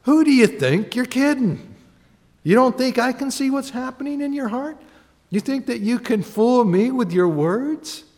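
see right through them; God calling the bluff. Acted. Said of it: "God's up there going".

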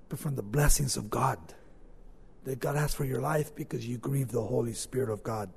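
ordinary delivery

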